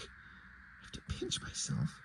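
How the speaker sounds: whispered, slow and stunned. Shown as a demonstration. Reviewer rereads the line in a stunned whisper. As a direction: breathe "pinch myself" in disbelief. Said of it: "What the fuck?".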